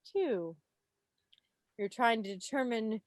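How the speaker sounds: ordinary delivery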